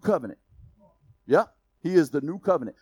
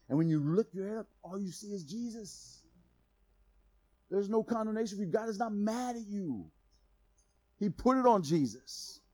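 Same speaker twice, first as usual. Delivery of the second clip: soft comfort; 2 semitones higher, 8 dB quieter than usual. Real, not acted. Preacher drops to comfort.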